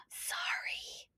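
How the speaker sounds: breathy whispered apology. A narrator's reading. Duck sister apologizing to Ollie, whispered. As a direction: whisper it so nobody wakes. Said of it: "Mandy whispered".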